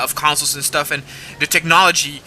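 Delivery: ordinary talk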